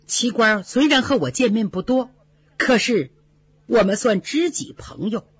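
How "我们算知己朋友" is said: plain reading voice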